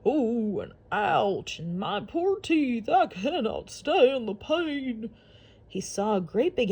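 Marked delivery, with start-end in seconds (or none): 0.1-5.1 slow, pained alligator voice; complaining alligator. Acted. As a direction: moan about sore teeth. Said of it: "heard a voice complain".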